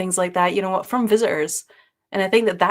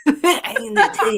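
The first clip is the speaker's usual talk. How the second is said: high, giggly playful claim; laughing; 7 semitones higher, 5 dB louder than usual. Pretend. Co-host chiming in on ASMR, laughing. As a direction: say it through laughter, pitched up.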